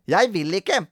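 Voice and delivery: put-on high-pitched childish refusal; cross little girl; stubborn. A narrator's reading. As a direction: dig in against the game. Grete refuses the game.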